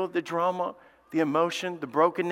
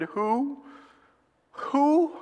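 high, cracking cry of disbelief; trembling disbelief; 10 semitones higher than usual. Acted. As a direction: ask it shaking, not wanting the answer. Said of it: "Isaac trembled very exceedingly and said".

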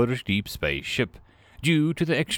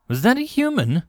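plain reading voice

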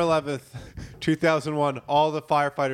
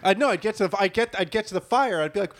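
ordinary talk

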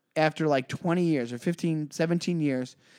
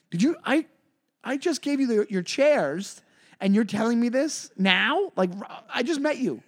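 incredulous; 6 semitones higher than usual. Retold or imitated. Incredulous reply to stranger.

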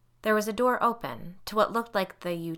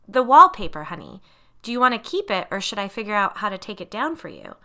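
forced light tone; 3 semitones higher, 11 dB louder than usual. A narrator's reading. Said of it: "my tone still forcibly light".

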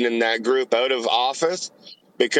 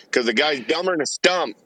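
usual speaking voice

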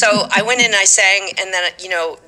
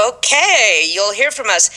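drawn-out, high-pitched "Okay"; sing-song it as a knowing send-off; pleased sign-off; 4 semitones higher than usual. Retold or imitated. Auditioners sending the singer off after the scene, pleased.

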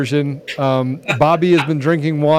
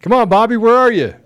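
calling out; 8 semitones higher, 6 dB louder than usual. Real, not acted. Calling out for late guest.

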